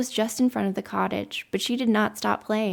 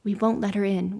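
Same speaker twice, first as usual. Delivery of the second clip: usual speaking voice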